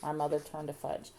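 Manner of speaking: plain reading voice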